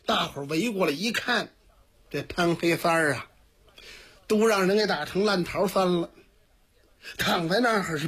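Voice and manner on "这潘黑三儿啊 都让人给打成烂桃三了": ordinary delivery, nothing marked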